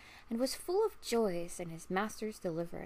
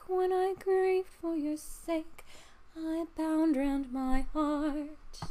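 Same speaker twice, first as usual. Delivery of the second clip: slow, high, much louder strained voice; a loyal old servant explaining, full of feeling; faithful servant answering; 7 semitones higher, 6 dB louder, slower than usual. A narrator's reading. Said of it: "But Harry answered".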